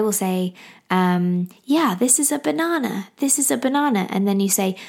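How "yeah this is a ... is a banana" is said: higher-pitched parent voice naming things; talking to baby. Shown as a demonstration. Mum naming fruit for her baby, baby-directed voice. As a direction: sing out simply, as to a baby. Demonstrating how she talks to baby.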